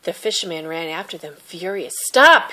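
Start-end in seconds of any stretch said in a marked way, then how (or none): 2.1-2.5 loud, high yell. Acted. Fisherman chasing My My, yelling loud. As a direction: bark it after them. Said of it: "he yelled".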